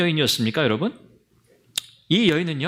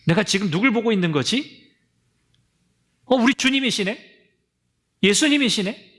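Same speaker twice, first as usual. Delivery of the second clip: dawning surprise; 4 semitones higher than usual. Acted. Mary dawning recognition.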